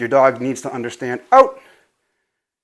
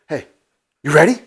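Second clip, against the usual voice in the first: hyping up the dog; 4 semitones higher, 3 dB quieter, faster than usual. Real, not acted.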